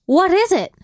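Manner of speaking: high-pitched, exasperated demand; impatient sergeant. Acted. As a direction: demand an answer from your soldiers. Sergeant slams fist, demands answer.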